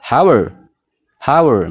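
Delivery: HOW ARE blended as "hawer", falling; linked pronunciation. Shown as a demonstration. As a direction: model How are as one word for repeating. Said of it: "Mee na waliin jedhaa". ("How are" walitti qabsiisuun "hawer")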